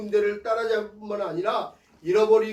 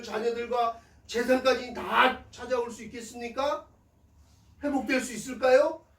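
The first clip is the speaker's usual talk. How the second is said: urgent, high-pitched imploring prayer; David praying; 5 semitones higher than usual. Retold or imitated. David asks God whether he can recover everything, urgent pleading prayer. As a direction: plead upward to God in anxious questions. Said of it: "어떻게 기도합니까?".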